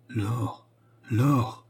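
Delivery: lightly voiced trembling old man; hesitant, pleading repetition of the name; timid stammer. A narrator's reading. Old duke to the widow leaving him, timid stammer. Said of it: "balbutia le vieillard, devenu plus tremblant".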